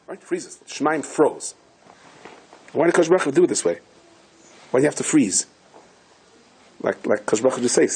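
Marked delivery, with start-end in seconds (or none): none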